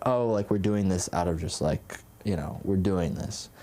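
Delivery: deflated mock obligation, voice dropping away; half-hearted fakeness. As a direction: act out a dutiful, empty greeting. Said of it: "like, fakeness to it sometimes".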